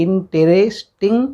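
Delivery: "इंटरेस्टिंग" in four drawn-out, separate beats; syllable by syllable. Shown as a demonstration. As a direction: break "इंटरेस्टिंग" apart for counting. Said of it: "जैसे बोल के देखिए". ("इंटरेस्टिंग" को खींचकर, तोड़-तोड़कर बोलें)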